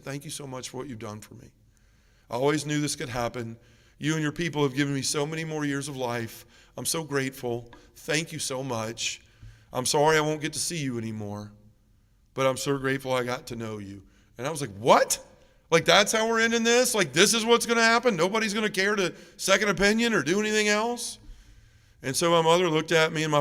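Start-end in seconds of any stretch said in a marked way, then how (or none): 0.0-14.0 soft, gentle thanks; peaceful gratitude. Retold or imitated. Dying mother thanking her doctor, retold with soft, peaceful gratitude. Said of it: "looked like this most peaceful angel". 14.8-21.2 high, incredulous outburst; disbelieving protest. Retold or imitated. Son protesting his mother accepting the diagnosis, retold in high disbelief.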